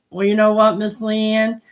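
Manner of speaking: ordinary delivery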